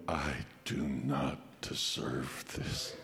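low, soft and slow in awe; a boy stunned by a gift; awed hush. Retold or imitated. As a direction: let the words fall out in disbelief. Boy sees the new sled.

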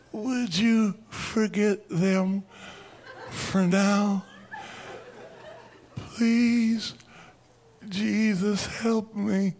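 high, slow begging with a laugh; pleading prayer. Retold or imitated. Eddie begging Jesus from the chimney, high pleading. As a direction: beg God, half laughing. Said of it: "and he says".

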